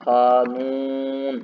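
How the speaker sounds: कानून very slow, long ू held; drawn-out word. Shown as a demonstration. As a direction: stretch the ू in कानून. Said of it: "हमको लिखना हो जैसे कि". (ऊ खींचकर कानून)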